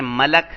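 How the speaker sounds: ملک said malak, a after m and a after l; pronounced malak. Shown as a demonstration. Teacher showing students the reading malak. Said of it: "کبھی اس کو کہتے ہیں".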